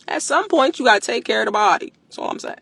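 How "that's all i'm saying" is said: breathy whispered aside. Real, not acted. Creator whispering a sly aside to live viewers. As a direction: drop to a whisper, just for them. Muttered aside after a point.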